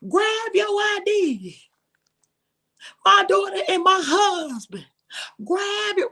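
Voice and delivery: high, loud shouted command; shouting at her family to grab their IDs; yelling orders. Retold or imitated. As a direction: bark it at the family like a drill. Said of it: "I tell them every time".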